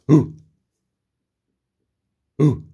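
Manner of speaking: short, sudden pigeon hoot; pigeon growl. Pretend. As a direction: imitate a pigeon with a quick ooh. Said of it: "Pigeons growling".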